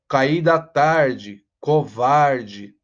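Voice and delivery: caí da tarde stretched out syllable by syllable; very slow. Shown as a demonstration. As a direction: drag it out to show the rhyme. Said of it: "como ele vai combinando". (bem devagar, marcando a rima)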